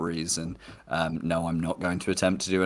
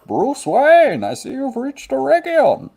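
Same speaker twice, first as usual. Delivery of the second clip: very high, loud theatrical villain; Bane; 7 dB louder, slower than usual. Acted. Performing for co-host who missed out, mock Bane voice. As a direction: greet your foe in a hammed-up villain voice. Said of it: "You got one more Bane accent for the week".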